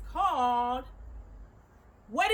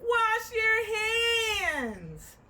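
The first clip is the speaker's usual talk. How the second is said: long, drawn-out shouted call, higher; called-out command; 6 semitones higher, much slower than usual. Shown as a demonstration. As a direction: belt it out like a coach calling a drill. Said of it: "Let's try it out".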